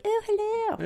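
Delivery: very high, put-on oh hello; posh phone voice. Shown as a demonstration. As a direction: swoop up in a posh telephone voice. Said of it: "I'm like".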